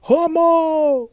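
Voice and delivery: high, loud yell from the back row; a heckler screaming from the back row; screamed slur. Retold or imitated. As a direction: hurl it across the hall at full voice. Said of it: "screaming at the top of his lungs".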